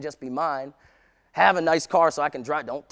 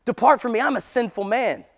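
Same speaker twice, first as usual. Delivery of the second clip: alarmed pleading Peter; 8 semitones higher, 9 dB louder than usual. Acted. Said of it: "he doesn't get all excited. He drops down".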